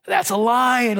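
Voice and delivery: mildly hammed-up excitement; stoked [name]. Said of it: "so stoked".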